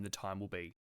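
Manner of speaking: ordinary talk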